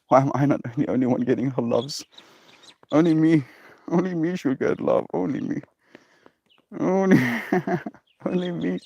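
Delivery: high whiny voice of a jealous dog; voicing her puppy's jealousy in a high whine. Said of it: "Puppy is like".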